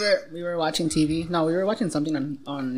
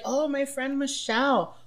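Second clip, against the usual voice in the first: put-on slow, higher innocent boyfriend voice; mock casual boyfriend; 7 semitones higher, 3 dB quieter, slower than usual. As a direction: play it too casual, like he's hiding nothing. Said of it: "Or they'd be like".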